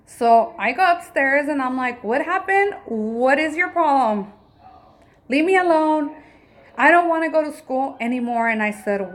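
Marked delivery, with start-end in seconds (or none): 2.0-4.3 high, pushy demanding question; exasperated mom. Retold or imitated. Mother confronting her son upstairs, high and exasperated. 5.3-8.5 put-on higher and louder, upset child's voice; sulky child. Mother imitating her son refusing school, higher and louder.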